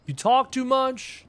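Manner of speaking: chat, slowly and mock-stern: you talk too much; mock scolding.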